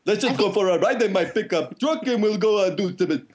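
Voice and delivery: goofy rural Ontarian, cartoon-bear bounce; Northern Ontario accent. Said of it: "that's how they talk in Northern Ontario".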